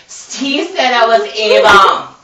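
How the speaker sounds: drawn-out mock howl. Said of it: "Steve howled".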